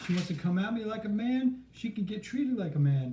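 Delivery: defiant driver quote. Retold or imitated. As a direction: play the driver who doesn't care. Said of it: "He's all".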